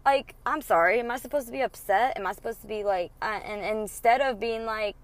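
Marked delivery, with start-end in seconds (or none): none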